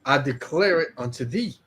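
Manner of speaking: slow, measured reading of scripture. Acted. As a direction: read it out like holy text. Reads scripture aloud.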